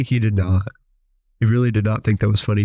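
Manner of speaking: ordinary talk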